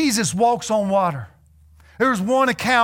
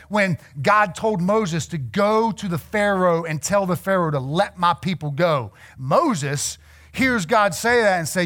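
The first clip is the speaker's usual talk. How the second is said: ordinary talk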